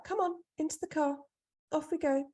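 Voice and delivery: high-pitched voice of a mum taking charge; brisk mum rallying. Retold or imitated. Mum rallies her into car.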